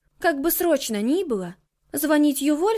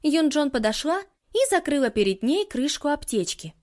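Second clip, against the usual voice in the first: ordinary delivery, nothing marked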